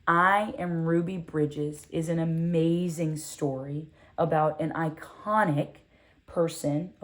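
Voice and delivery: ordinary delivery, nothing marked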